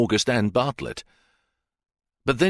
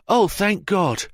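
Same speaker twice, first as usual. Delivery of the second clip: much higher, slow rush of relief; relieved cry; 11 semitones higher, 3 dB louder, slower than usual. Acted. Officer reaches the rescued man, high relieved cry. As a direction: cry out as the worry breaks. Deans runs over in relief.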